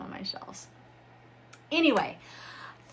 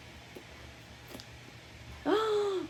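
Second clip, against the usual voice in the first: sharp delighted intake of breath; awed gasp; 12 semitones higher, 3 dB quieter than usual. Real, not acted. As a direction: gasp at something gorgeous. Sees the special edition.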